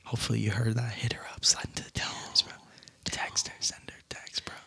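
secretive whisper to the guy. Pretend. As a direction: hush it like a conspirator. Whispering advice to listener.

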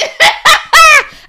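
high, loud peals of laughter; loud cackling laugh. Real, not acted. Friend teasing about a crying groom, loud laughter.

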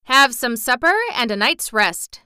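plain reading voice

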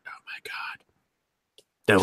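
hushed mock awe; awed whisper. Imagined viewer reacting to the show, awed whisper. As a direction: whisper it, as if overwhelmed.

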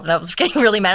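ordinary talk, nothing marked

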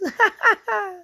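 squeaky high laugh in falsetto; falsetto giggle. Shown as a demonstration. Laughing at his own squeaky voice demo, falsetto giggle. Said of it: "a high-pitched voice like this".

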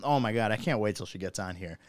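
usual speaking voice

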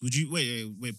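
ordinary talk

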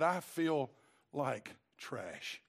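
low, hushed admission sinking away; defeated. Retold or imitated. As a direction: imitate a crushed confession, dropping very low at the end. Retells defeated woman's confession.

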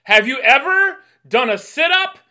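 barked high and loud at a recruit; drill sergeant yell. Retold or imitated. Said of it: "looks at me and goes".

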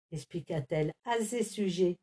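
usual speaking voice

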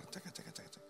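soft voiceless sizzle; sizzling noise. Retold or imitated. Imitating satay on the grill, soft sizzle. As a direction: hiss like meat on the grill.

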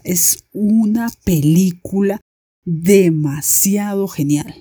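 ordinary talk

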